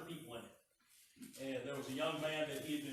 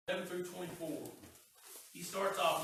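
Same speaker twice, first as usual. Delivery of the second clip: ordinary talk, nothing marked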